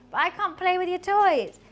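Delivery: high, loud plea to a pet; exasperated protest. Real, not acted. Streamer telling pet playtime must wait, high loud protest. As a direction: raise voice and pitch in protest.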